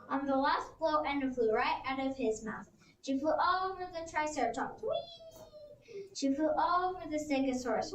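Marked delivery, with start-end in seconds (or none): 4.8-6.0 much higher, soft squeal of delight; high gleeful whee. Acted. Edna flying over the Triceratops, high soft whee.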